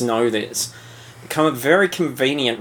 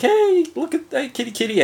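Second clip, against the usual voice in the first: much higher coaxing call to a cat; 13 semitones higher than usual. Retold or imitated. Nick Fury on the plane calling the cat, much higher coaxing voice. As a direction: sweet-talk the kitty over. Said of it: "and he's like".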